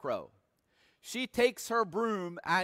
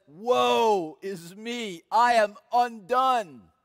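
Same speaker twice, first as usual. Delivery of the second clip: slow, solemn "Woe is me"; King James lament, drawn out; 3 semitones higher, 5 dB louder, slower than usual. Retold or imitated. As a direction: let the words fall like a mourning cry. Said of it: "The King James Version says".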